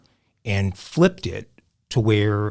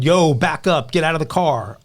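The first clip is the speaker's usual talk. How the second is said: higher-pitched, mildly raised retold shout; pitched-up mock yell; 6 semitones higher, 3 dB louder than usual. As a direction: imitate an officer yelling a warning, not full force. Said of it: "So he yelled".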